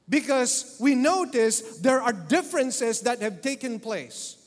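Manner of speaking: loud, high, urgent sermon voice; full-force preaching. Acted. Pastor presses the church about lost first love, loud and fervent. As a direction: preach it hard to the congregation.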